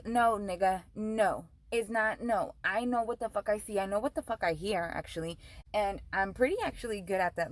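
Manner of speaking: ordinary delivery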